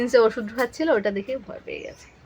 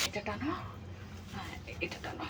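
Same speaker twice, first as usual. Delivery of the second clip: whisper; 18 dB quieter than usual. Real, not acted. Mother whispers to her son to pull off his shirt. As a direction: breathe the words gently. Quiet instruction while undressing child.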